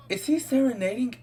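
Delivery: high and loud, disbelieving; shocked disbelief. Real, not acted. Gamer sees the visitor serenading, high loud disbelief. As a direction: cry out in disbelief.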